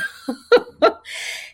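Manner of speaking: high breathy unvoiced giggle; can't hold the laugh in; real burst of laughter. Real, not acted. Laughing at her own joke in the delivery room, giggling.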